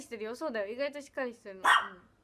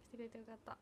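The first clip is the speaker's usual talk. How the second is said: 「来てくれてよかった」 repeated much softer, almost to herself; soft echo; 5 semitones lower, 19 dB quieter, much faster than usual. Real, not acted. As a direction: echo 「来てくれてよかった」 under your breath. Repeats thanks softly. (「来てくれてよかった」を小声で繰り返す)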